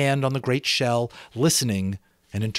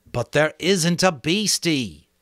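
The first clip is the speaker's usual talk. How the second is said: slow, firm reassurance; boy Ralph, firm; 6 semitones higher, 4 dB louder, slower than usual. Acted. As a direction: settle the matter. Ralph voiced.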